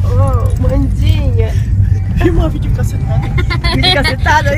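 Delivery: voz sacudida pelo carro balançando; em estrada esburacada. Real, not acted. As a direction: fala chacoalhando no caminho. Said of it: "tá tremendo tudo". (voz trêmula com o carro balançando)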